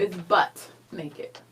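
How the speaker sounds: slow, emphatic "butt" with a T; stress on BUTT. Shown as a demonstration. Contestant insisting on her answer, slow stressed "butt". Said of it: "B U T T".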